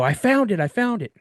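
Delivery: calling across the woods; mock yell. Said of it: "So I yell to my dad".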